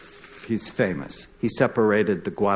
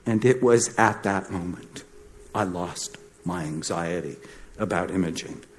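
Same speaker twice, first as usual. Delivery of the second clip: ordinary delivery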